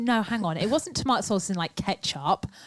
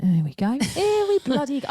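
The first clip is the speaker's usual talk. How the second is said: exasperated; 5 semitones higher, 4 dB louder than usual. Retold or imitated. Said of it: "I was like".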